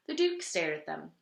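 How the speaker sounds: usual speaking voice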